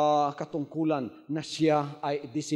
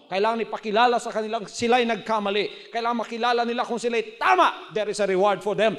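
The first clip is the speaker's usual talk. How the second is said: normal talking voice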